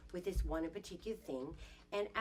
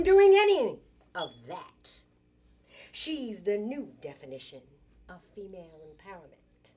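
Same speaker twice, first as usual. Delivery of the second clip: loud, emphatic openings easing at phrase ends; dramatic poem declamation; 3 semitones higher, 13 dB louder than usual. Acted. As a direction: proclaim it like a rousing spoken-word poet. Resumes declaiming her poem.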